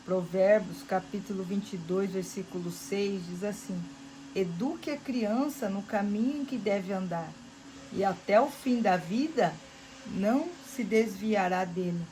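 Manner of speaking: versículo proclamado bem mais alto; pregação inflamada. Acted. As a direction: proclame como no púlpito. Proclamando o versículo em voz alta. (pregação em voz alta e firme)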